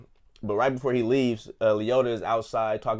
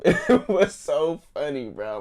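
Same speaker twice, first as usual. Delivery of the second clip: laughing; 6 semitones higher, 6 dB louder, slower than usual. Real, not acted. Laughing at the joke.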